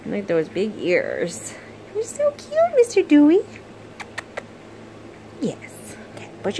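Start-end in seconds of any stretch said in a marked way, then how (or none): 1.9-3.4 high sing-song pet talk, much higher; baby talk to a pet. Acted. Owner greeting the puppy, high cooing baby talk.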